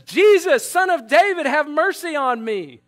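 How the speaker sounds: loud, high acted cry for mercy; yelling out. Blind Bartimaeus calling to Jesus as he passes, acted yell. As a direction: call out loudly, drawing out the final plea. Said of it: "he yells out and he says".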